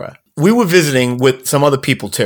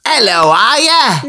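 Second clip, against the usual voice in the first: high, slow mock Cockney call; badly faked Australian that lands in Cockney; Cockney attempt at Australian; 9 semitones higher, 3 dB louder, slower than usual. Host trying Australian for listeners, mistaken Cockney accent. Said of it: "No, that's Cockney".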